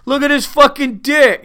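high, loud hammed-up drunk guy, laughing at the end; mock drunk dude, high and loud; drunk guy yelling. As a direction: yell it like a drunk guy mooning the street. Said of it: "he wouldn't be like".

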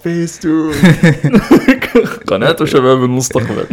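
high, bright channel ident through a laugh; sing-song cartoon channel announcer; Spacetoon announcer. Imitating the Spacetoon channel jingle.

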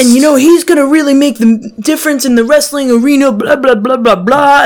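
high, loud grandiose promo voice; wrestler promo speech. Retold or imitated. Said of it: "he's like".

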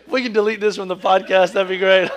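can't get the pun out for giggles; giggling. Real, not acted. Preacher giggling at her own corny pun, laughing through. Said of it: "I don't even wanna say it 'cause it's so corny".

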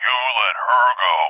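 deliberate creature retort, well below the frantic plea; menacing elephant voice. Retold or imitated. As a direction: answer coolly as a threatening beast. Elephant answers back in creature voice.

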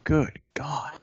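breathy whispered "good God"; whispered disbelief. Real, not acted. Stunned reaction to bad stat.

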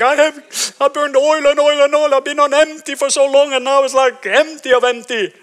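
high, loud, exasperated outcry; loud high lament. Retold or imitated. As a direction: shout out being burned empty. Crying out his exhaustion.

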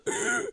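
sharp high gasp; dramatic gasp. Retold or imitated. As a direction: gasp in mock shock. Mimicking villain's dramatic gasp.

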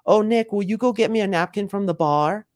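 high sweet-talking favor request; cute, gentle woman. Acted. Woman asking a man for a favor, cute and gentle. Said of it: "She'll be real cute and real gentle".

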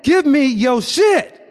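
slow, high, loud robber's yell. Retold or imitated. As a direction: bark it like a gunman. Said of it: "the guy yelled at me".

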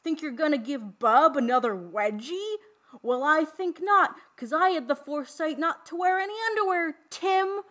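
high, loud, slow campy voice; hammed-up campy Bub, half-teasing Tim; Bub impression. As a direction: camp it up like a goofy teen killer victim. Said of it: "I'm gonna do my best impression".

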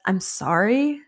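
a curt, dismissive "I'm sorry" with a rise on "sorry"; a quick, careless "I'm sorry", apologising without meaning it; condescending sorry. Retold or imitated. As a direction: brush her off with a mock apology. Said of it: "That is the most condescending way to say".

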